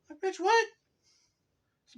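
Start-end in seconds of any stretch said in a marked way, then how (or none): 0.1-0.7 shrieking, much higher, loud; outraged disbelief. Real, not acted. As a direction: shoot it up in shocked outrage. Shocked at sister's remark.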